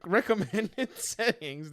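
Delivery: laughing. Real, not acted. Reading the recommended specs to viewers, slow and laughing. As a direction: giggle out the words. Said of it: "I don't know why I'm laughing".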